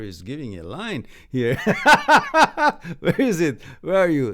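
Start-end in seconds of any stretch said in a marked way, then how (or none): 1.6-2.9 a loud, high burst of laughter. Real, not acted. Laughing at a viewer's line, loud and high.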